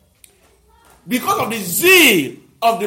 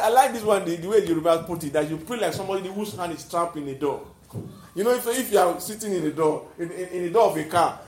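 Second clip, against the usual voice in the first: normal talking voice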